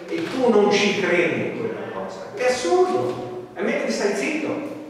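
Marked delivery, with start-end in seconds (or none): 2.3-4.8 mock the absurdity; scornful disbelief. Real, not acted. Preacher scoffs at unbelief.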